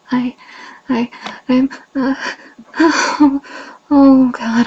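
rising cries building to loud gasps; moaning. Acted. She tries to speak near climax.

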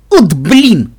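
loud frustrated groan. Acted. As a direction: groan it out, fed up. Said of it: "простонал Каламити". (со стоном, раздосадованно)

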